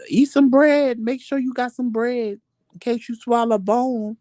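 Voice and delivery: grandma warning. Retold or imitated.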